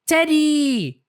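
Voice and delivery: high delighted shout of the name; high happy squeal; joyful cry. Acted. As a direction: cry out with joy at the found bear. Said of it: "cries Peppa".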